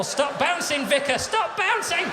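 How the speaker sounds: high, panicked calling out; mock panic. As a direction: yell it high as if pinned and helpless. Panicked shout on bouncy castle.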